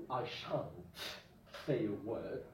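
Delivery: I shan't said low and soft; quiet formal refusal; low understated shan't; formal example. Shown as a demonstration. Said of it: "with a future meaning".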